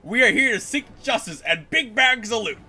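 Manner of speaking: mock-noble hero speech; lightly hammed pompous hero; pretentious hero. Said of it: "do something pretentious and heroic".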